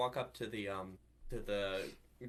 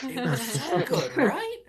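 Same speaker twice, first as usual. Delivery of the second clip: high, loud laughing agreement; 13 dB louder, slower than usual. Real, not acted. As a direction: squeal it through laughter. Laughing about the cough.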